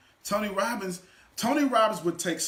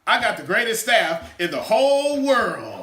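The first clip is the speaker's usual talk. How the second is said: louder, rising hype call; hyped announcement; 5 semitones higher, 9 dB louder than usual. Shown as a demonstration. Speaker to audience, hyped call for a cheer. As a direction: pump up the crowd. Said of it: "watch this here".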